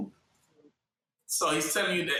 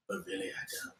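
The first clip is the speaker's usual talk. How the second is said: whispered hidden truth, much softer; 12 dB quieter, faster than usual. Retold or imitated. Preacher voicing Esau's hidden thought, whispered. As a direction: breathe the aside as a secret whisper. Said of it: "So this is that whisper part for me".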